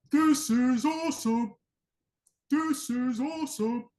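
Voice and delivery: high, strained, drawn-out mock cheer with no full voice behind it; badly faked crowd excitement, slow and high; pretend hype. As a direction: act juiced without really letting go. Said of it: "people pretend to be juiced".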